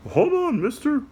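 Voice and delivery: put-on a little puppet explaining to kids; high cartoon helper voice. Puppet interrupts.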